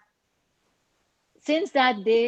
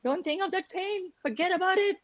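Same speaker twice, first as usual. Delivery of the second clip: a hint of the doctor, pitched higher and brisk; quoting the doctor; 10 semitones higher than usual. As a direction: imitate his cheerful command. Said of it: "saying that".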